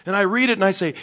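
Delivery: ordinary delivery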